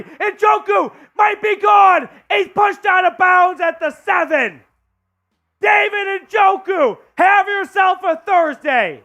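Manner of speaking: yelling the big play in full voice; excited shouting. Real, not acted. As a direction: roar it like a fan watching a touchdown. Touchdown-drive call, fan shouting.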